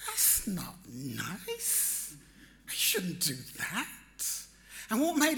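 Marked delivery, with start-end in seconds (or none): 0.1-3.9 soft, slow, pained voice of a gentle wife; wife hears the crowd booing, a soft slow pained reproach; imitating wife's gentle reproach. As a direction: plead softly and slowly that it isn't nice. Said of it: "her reaction was".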